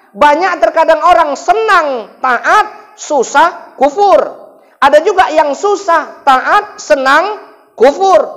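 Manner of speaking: rhythmic list, each word lifted; rhythmic preaching. Acted.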